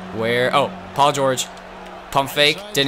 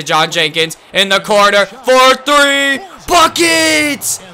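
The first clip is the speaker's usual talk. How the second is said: slow build into a shouted, higher call; hyped play-by-play; 9 semitones higher, 6 dB louder, slower than usual. Real, not acted. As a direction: sell the three like a courtside announcer. Calling his own shot.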